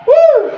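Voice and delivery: high, loud whoop of energy; excited whoop. Real, not acted. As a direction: let out a hype whoop. Coach whooping mid workout. (grito de euforia)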